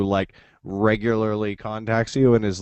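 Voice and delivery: ordinary talk, nothing marked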